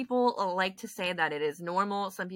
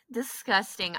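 ordinary talk, nothing marked

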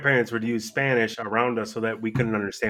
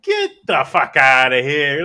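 drawn-out disbelief; 2 semitones higher, 8 dB louder, slower than usual. Retold or imitated. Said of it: "I was like".

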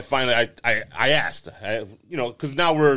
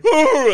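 imitated cry; 12 semitones higher, 7 dB louder than usual. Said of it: "And I hear".

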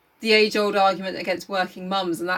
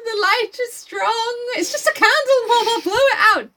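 hammed-up dying mother; 12 semitones higher, 3 dB louder than usual. Acted dying mother and daughter.

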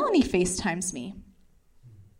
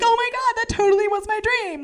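high, gushing exclamation; mock excitement; 10 semitones higher than usual. Pretending to react to friend's dream, gushing. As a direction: gush it in a pitched-up voice.

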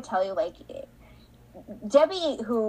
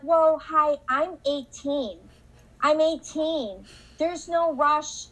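slow calming reply; 4 semitones higher, much slower than usual. Retold or imitated. Retold reply, slowed.

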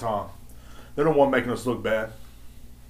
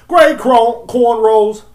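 high, very loud disbelief; incredulous shout; 9 semitones higher, 11 dB louder, much slower than usual. Real, not acted. Podcaster recalling an older man's gray cornrows, incredulous shout. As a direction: burst out at the absurdity. Incredulous outburst at homie's sighting.

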